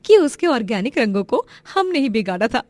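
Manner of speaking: high cackles around a smug boast; gloating villain's wife. Acted. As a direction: crow over the secret, laughing. (कुटिल हँसी के साथ इतराती पत्नी)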